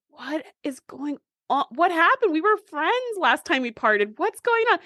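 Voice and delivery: high-pitched, mock-hurt disbelief; bewildered dismay. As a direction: plead in theatrical confusion, pitch climbing. Acted dismay at a friend's hostility.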